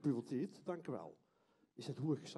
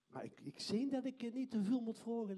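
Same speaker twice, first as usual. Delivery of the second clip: normal talking voice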